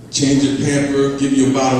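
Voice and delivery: normal talking voice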